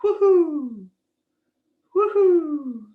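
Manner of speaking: high, gentle owl hoot. Shown as a demonstration. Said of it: "And an owl goes".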